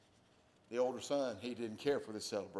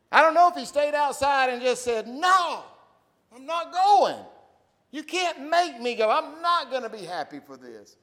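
much higher, louder shouting; shouting preacher; 15 dB louder than usual. Acted. Pastor shouting the son's refusal to the church. Voicing a pouting son.

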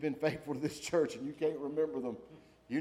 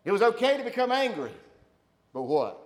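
rising fervor; 8 semitones higher, 10 dB louder than usual. Real, not acted.

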